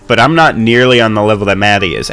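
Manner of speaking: ordinary delivery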